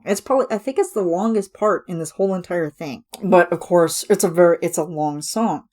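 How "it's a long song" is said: ordinary talk, nothing marked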